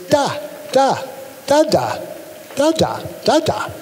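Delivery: soft, quiet "da, da" babble like an infant in the crib; dreamy baby-babble of "da"; baby babbling da. Shown as a demonstration. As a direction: let the "da" syllables drift out softly.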